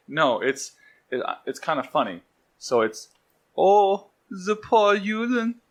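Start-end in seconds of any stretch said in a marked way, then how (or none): 3.6-5.6 high-pitched mock German accent; hammed-up, creepy German, pitched way up. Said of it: "my favorite of the German accents".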